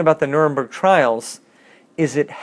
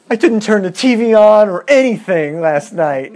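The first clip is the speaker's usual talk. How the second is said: mock whiny king; 6 semitones higher, 5 dB louder than usual. Mocking the king's complaint.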